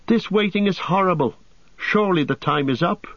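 high, fretful hobbit voice; impatient hobbit. A narrator's reading. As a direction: restless and high. Pippin fretting at the long wait.

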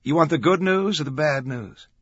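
high, loud call to the driver; yelling over traffic. A narrator's reading. Macri calls from the back of the van, yelling. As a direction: call out over road noise. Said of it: "yelled to Glick over the sound".

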